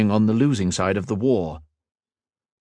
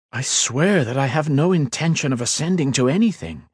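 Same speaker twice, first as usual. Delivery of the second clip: a hint of a calm young lord; level and sincere, raised a little; earnest mage, lightly voiced; 6 semitones higher than usual. Ethan explaining to the Queen, lightly voiced earnest.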